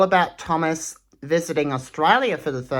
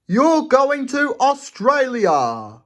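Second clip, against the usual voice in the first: higher, slow, beaming boss announcement; Fat Controller voice; 9 semitones higher, 4 dB louder, slower than usual. Acted. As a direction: draw it out like a proud boss. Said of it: "there's the Fat Controller saying".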